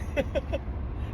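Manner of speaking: high, breathless nervous giggle; involuntary nervous chuckle; nervous laugh. Real, not acted. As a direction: let out a real uneasy laugh. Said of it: "the nervous laugh".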